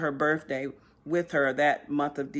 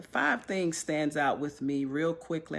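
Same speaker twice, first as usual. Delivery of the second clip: ordinary talk, nothing marked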